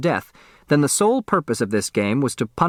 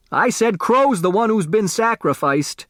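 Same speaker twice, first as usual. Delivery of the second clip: pitched up high, pressing the point again; insistent repeat; 9 semitones higher than usual. A narrator's reading. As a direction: repeat it higher and more insistent, as if he wasn't heard. Repeats louder when asked.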